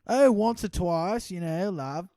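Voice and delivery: high, loud, slow mock Brit; hammed-up Cockney charm; British accent. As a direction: lay on a chirpy British accent. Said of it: "no, that's British".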